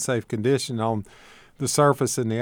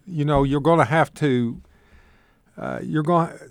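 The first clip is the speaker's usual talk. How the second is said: stumbling, halting restarts; losing the thread mid-sentence; fumbling for words; 4 semitones higher than usual. Real, not acted. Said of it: "I sound like I'm stumbling here".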